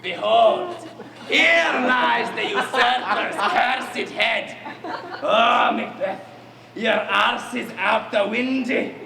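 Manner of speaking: slow, grand Scottish proclamation with a laugh; jokey Scottish accent. Acted. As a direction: crow over the head in Scots. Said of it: "in perfect Scottish accent".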